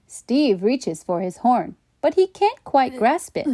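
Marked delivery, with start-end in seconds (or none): none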